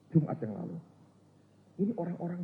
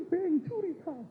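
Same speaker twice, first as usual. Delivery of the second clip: lightly voiced higher accusing preacher; retold accusation; slower than usual. Preacher pointing at congregants, mild mock accusation. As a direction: point and accuse, a hint of the shout. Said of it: "Dia tunjuk itu".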